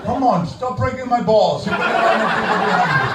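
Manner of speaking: high, loud mock exasperation; fed up. Donut-shop quarrel with a queue waiting, exasperated. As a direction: rush the end, hammed-up impatience. Clerk or customer acted as fed up.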